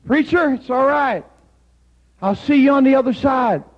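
high, loud called-back reassurance; condemned man calling to the preacher; retold yell. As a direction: shout it back down the hall. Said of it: "He yelled".

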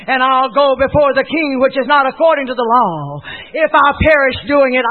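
loud, high, defiant declaration; impassioned preacher acting out Esther; dramatic. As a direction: proclaim Esther's resolve with force. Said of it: "Esther responded so dramatically".

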